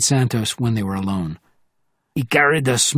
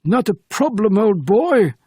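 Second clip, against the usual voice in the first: put-on high, posh-sounding English accent, raised; British accent, pitched well up, a touch louder; perfect English accent; 9 semitones higher, 4 dB louder than usual. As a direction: clip it out like a proper English gent, pitched up. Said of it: "said the Frenchman in a perfect English accent".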